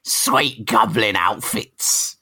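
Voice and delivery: drawn-out, shrieked villain impression; Green Goblin voice. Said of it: "That's how I'm hearing him".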